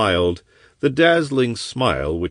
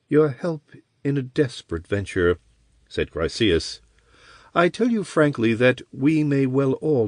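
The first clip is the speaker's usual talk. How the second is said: higher, lightly voiced sorceress; woman voice; 6 semitones higher than usual. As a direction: persuade him as a sly sorceress. Sorceress explains the venture.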